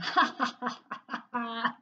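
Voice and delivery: long villain chuckle; smug evil laugh; gloating chuckle. A narrator's reading. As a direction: chuckle to himself, pleased. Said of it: "chuckled to himself".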